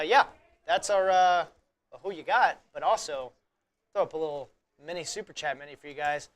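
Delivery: normal talking voice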